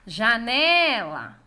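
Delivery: "Janela" called loudly, NE drawn out; calling out the word. Shown as a demonstration. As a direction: call out "Janela", stretching the NE. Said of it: "esse exercício de chamar as palavras".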